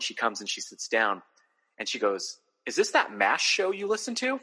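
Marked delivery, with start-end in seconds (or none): 2.7-4.4 lightly voiced wife, asking, voice rising high; a mild impression of his wife, curious; wife's puzzled question. Husband retells wife's question.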